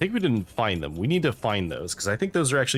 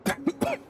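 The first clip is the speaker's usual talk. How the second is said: sharp choking cough; coughing up water. Real, not acted. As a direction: cough after swallowing wrong. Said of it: "Water went down the wrong pipe".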